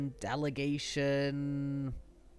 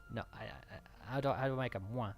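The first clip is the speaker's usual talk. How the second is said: normal talking voice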